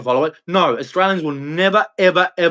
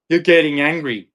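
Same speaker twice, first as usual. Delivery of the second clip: ordinary talk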